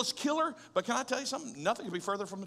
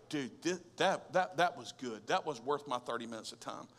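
low, softer musing to himself; impressed, understated; 8 semitones lower, 5 dB quieter than usual. Retold or imitated. Preacher retelling his reaction to one good paragraph, quietly impressed. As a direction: drop low, as if quietly won over. Retelling being won over by book.

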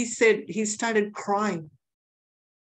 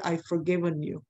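gentle forgiveness; 2 semitones lower, 4 dB quieter than usual. Retold or imitated. Jesus softly forgives the weeping prisoner, tender and quiet. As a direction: quietly lift the burden from him.

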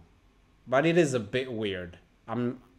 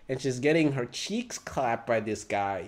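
ordinary delivery

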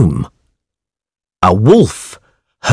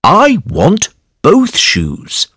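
high, slow greedy farmer voice; drawn out and greedy, pitched up; 9 semitones higher, slower than usual. Acted. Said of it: "said the farmer".

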